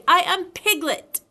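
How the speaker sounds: stamp out each word; loud indignant correction. A narrator's reading. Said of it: "said Piglet loudly".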